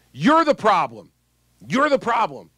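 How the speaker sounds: retold yelling. Retelling an angry voter yelling at him, mock yell. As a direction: throw the blame at him, half-mocking her shout. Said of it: "And she's yelling at me".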